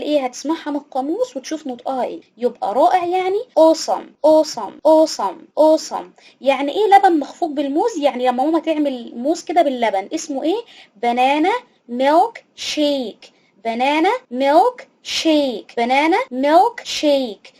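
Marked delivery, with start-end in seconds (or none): 4.3-6.1 "awesome" with the first part said "o", "osam"; "awesome" stretched slowly, opening on a long "o". Shown as a demonstration. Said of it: "هنطقه كأنه أو". 11.0-17.5 slow model phrase. Shown as a demonstration. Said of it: "ركز في نطقها".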